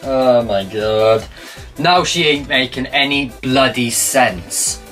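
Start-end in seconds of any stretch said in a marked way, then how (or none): none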